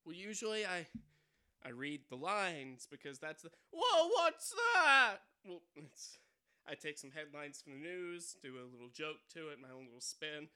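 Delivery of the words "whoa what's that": loud, slow, high "whoa"; startled robber. Acted. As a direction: jump at the noise.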